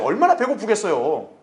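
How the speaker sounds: ordinary talk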